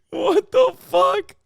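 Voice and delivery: high, loud, slow outraged yell; shocked shout. Real, not acted. Streamer shocked by the politician's answer, slow loud yell. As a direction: stretch it out in disbelief.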